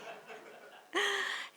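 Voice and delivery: high laugh. Real, not acted. Author after a joke didn't land, high laugh. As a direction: laugh at your own failed joke.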